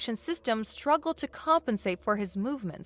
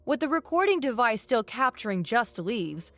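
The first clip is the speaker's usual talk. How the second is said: plain reading voice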